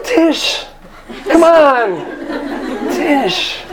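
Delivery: high-pitched, hammed-up call after someone; mock pleading call. Stranded traveler calls to Tish, high comic pleading.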